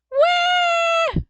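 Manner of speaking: very high, loud cartoon wail; sudden sob. A narrator's reading. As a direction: burst into a long childish bawl. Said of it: "suddenly sobbed".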